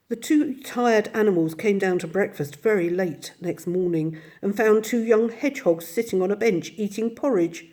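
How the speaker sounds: ordinary delivery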